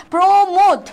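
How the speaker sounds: "প্রমোদ" with both vowels closed like ও; closed o sounds. Shown as a demonstration. Teacher joining syllables for students, "প্রমোদ" with closed o. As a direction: join "প্রো" and "মোদ" firmly into "প্রমোদ". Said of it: "ও এর মতো উচ্চারণ হবে".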